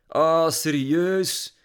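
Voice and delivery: loud, high, drawn-out disbelief; long exasperated whine; eye-rolling exasperation. A narrator's reading. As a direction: drag it out, totally fed up. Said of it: "Lily rolde met haar ogen".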